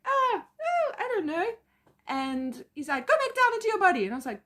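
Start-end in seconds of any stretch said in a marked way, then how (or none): 0.1-1.5 very high, loud mock panic; flustered wail. Answering the man on the pyramid, flustered wail. 3.1-4.1 high, loud, fast order; imitated shouting. Man ordering her back into her body, mock shouting. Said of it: "he starts shouting at me".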